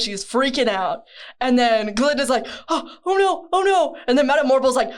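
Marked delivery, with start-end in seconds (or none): 2.7-4.0 high, fretful oh-nos; panicking Glinda. Retold or imitated. Retelling Wicked, Glinda's high panicked oh-nos. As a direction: play a flustered blonde panicking.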